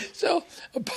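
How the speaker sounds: squealing giggle breaking the word; high laugh. Real, not acted. Comic breaking down in high laughter.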